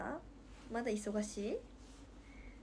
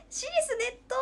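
high, teasing repeat of a silly word; high mock question; squeaky mock query; 12 semitones higher, 7 dB louder than usual. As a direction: toss the odd word back in a squeaky voice. Repeating odd word, amused.

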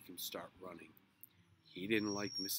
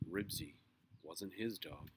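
usual speaking voice

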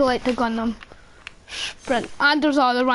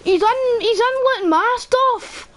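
agitated; 9 semitones higher than usual. Real, not acted. Watching enemy loot his stuff.

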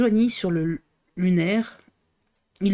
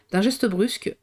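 usual speaking voice

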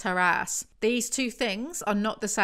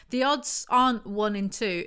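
ordinary talk, nothing marked

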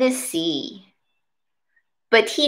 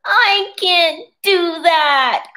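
high, slow pleading cry; 7 semitones higher, 3 dB louder, much slower than usual. Acted. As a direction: wail it out, slowly. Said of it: "cried the rainbow fish".

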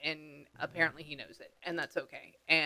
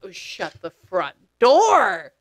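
astonished; 5 dB louder, much slower than usual. Real, not acted. Medium stunned by news of the son, astonished exclamation. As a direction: draw it out, burst on the last word.

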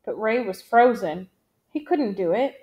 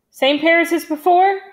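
firm, raised, higher-pitched question; hard voice; 7 semitones higher, 7 dB louder than usual. A narrator's reading. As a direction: say it hard, like a squad leader. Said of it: "his voice hard".

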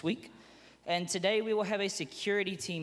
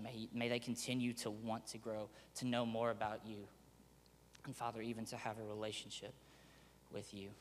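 prayer; 5 semitones lower, 9 dB quieter than usual. Acted. Pastor leads an opening prayer.

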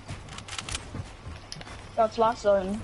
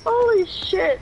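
high, loud shriek of laughing disbelief; excited scream; 7 semitones higher, 4 dB louder, slower than usual. Real, not acted. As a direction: let out a winner's shriek. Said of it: "That was like a scream".

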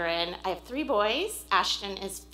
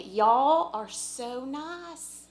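slow, drawn-out Southern drawl on y'all, higher; Southern accent; 4 semitones higher, 5 dB louder, much slower than usual. Pretend. Thanking the church folks, in a hammed-up Southern drawl. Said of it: "bring out a little bit of my Southern accent".

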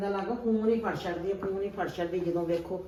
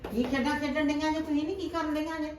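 higher, nagging demand; scolding grandma; 9 semitones higher than usual. Acted. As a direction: demand to know what they're up to. Grandma scolds playing girls.

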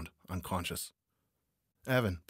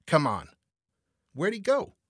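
loud, high, urgent yell; urgent calling; 10 semitones higher, 9 dB louder than usual. A narrator's reading. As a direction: shout to your partner mid-chase. Urging partner on in chase.

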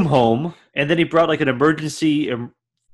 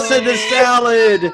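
mock wail; 7 semitones higher, 4 dB louder than usual. Mock wail over thrown salad.

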